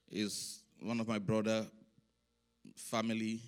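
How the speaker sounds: subdued and halting, holding back tears; soft testimony with long pauses; a little emotional. Real, not acted. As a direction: share it quietly, moved. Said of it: "if I'm a little bit emotional".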